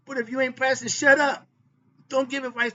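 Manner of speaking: sudden loud, high blast of scorn; shouting. Real, not acted. Preacher telling hypocrites to stop talking, shouted. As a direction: explode at the hypocrites. Preaching against empty advice.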